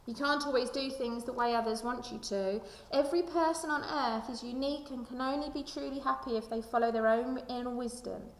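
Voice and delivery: ordinary delivery, nothing marked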